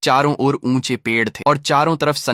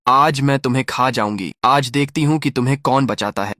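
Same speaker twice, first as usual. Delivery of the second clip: plain reading voice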